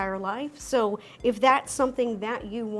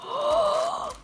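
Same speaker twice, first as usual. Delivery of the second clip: delighted gasp. Real, not acted. First sight of Dubai chocolate.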